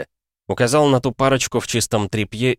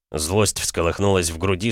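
plain reading voice

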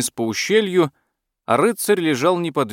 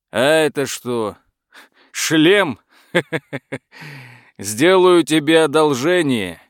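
mocking dragon; 2 semitones higher, 3 dB louder than usual. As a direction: scoff at the knight like a dragon.

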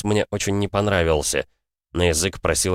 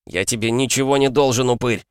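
higher, angry yell; 6 semitones higher than usual. A narrator's reading. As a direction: shout the insult at him. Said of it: "заорал я". (яростный крик)

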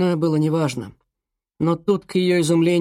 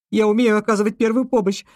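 urgent; 7 semitones higher, 4 dB louder than usual. Acted. Girl rushes to help brother.

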